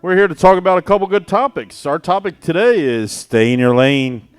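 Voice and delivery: loud, fast hype announcer voice; monster truck ad. Real, not acted. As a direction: shout it like a monster truck commercial. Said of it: "I don't know why I'm talking like a, a monster truck advertisement".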